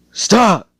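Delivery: sudden high, loud scream; panicked scream on waking; screaming stop. Retold or imitated. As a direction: wake up screaming it out of a nightmare. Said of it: "he screams out".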